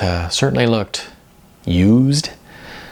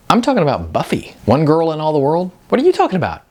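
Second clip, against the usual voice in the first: excited insistence; 7 semitones higher, faster than usual. A narrator's reading. Sean defends his Buffy reference.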